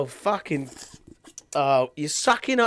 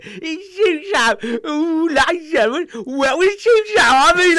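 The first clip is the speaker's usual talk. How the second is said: that silly voice; 11 semitones higher, 5 dB louder than usual. Acted. Host teasing his co-host, high wailing whine. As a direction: whimper the show name like a crybaby. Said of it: "stop doing that fucking voice".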